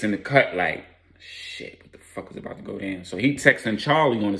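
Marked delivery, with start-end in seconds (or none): 1.2-3.0 hushed, low muttering to himself; worried aside. Acted. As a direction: say it under your breath, much softer.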